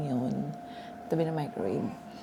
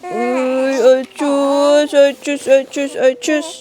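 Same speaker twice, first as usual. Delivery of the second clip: high, loud rhythmic shushing coo; 8 semitones higher, 12 dB louder, slower than usual. Real, not acted. Mother hushing her crying baby, high rhythmic coo.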